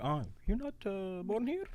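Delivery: high, surprised question; mock shock at the news; surprised driver. Retold cab driver's surprise.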